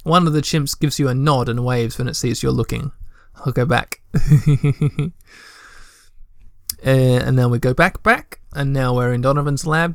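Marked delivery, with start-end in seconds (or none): 4.1-5.1 short rapid chuckle; quick run of light giggles. Real, not acted. Player amused while clicking back through the game, giggling.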